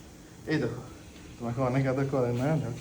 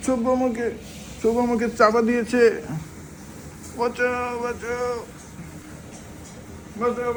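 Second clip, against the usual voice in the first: put-on higher, playful baby voice calling for help; mock cry for help; 7 semitones higher than usual. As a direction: ham up a little one pinned down, calling for rescue. Voicing baby, mock cries for help.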